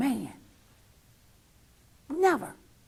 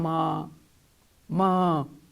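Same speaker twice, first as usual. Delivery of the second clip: imitating her son, a lower pleading "Mom"; begging "Mom"; 8 semitones lower than usual. Son wanting something from his mother, lower pleading tone. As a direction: lay it on like a son about to beg. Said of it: "But when he wants something".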